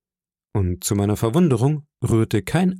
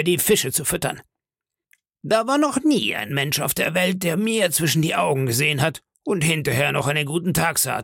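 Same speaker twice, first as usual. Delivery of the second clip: menacing ringleader, pitch raised; Silver; 6 semitones higher than usual. Acted. Pirate leader puts the mutineer in his place, threatening. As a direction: threaten the mutineer in a higher, commanding voice.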